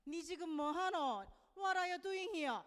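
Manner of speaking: loud, lilting Busan intonation; punchy Busan-accented Korean and English; Busan dialect accent. Shown as a demonstration. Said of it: "어떤 식으로 억양이 되냐면".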